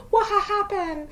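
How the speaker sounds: high, broken up by giggles; laughing imitation. Mimicking friend who slept through it, laughing. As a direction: squeal the question through laughter.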